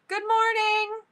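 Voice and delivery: very high, held-out greeting; high, drawn-out call for the camera; photo-pose greeting. Real, not acted. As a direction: sing it out high on a steady note. Posing for a group picture.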